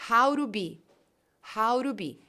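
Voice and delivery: "How to be" linked as "hauro bi"; tapped R for the T in TO, words joined; connected speech. Shown as a demonstration. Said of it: "hora que eu junto".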